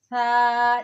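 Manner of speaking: "सा" said softly, tongue tip touching the upper teeth; soft thaa. Shown as a demonstration. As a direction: give "सा" a soft lisped th sound. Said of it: "नरमी से पढ़ना है". ("सा" नरमी से, ज़ुबान की नोक दांतों पर)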